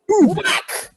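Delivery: ordinary talk, nothing marked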